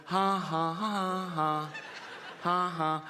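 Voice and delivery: deliberate fake "ha ha" laugh; mock laugh. Taunting a celebrity on purpose, fake laughing. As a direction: spell out each ha, taunting.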